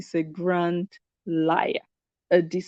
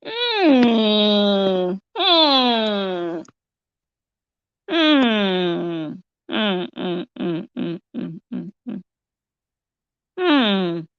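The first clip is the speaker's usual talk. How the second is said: disgusted groans; much slower than usual. Real, not acted. Reacting to disgusting video.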